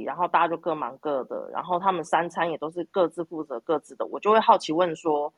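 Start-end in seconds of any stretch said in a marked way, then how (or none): none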